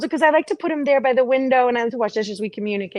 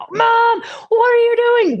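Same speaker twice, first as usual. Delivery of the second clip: put-on kid calling out; 11 semitones higher, 4 dB louder, slower than usual. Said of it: "kids calling out".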